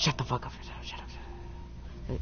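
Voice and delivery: hushed urgent whisper. Real, not acted. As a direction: hiss it so you're not heard. Whispering while hiding.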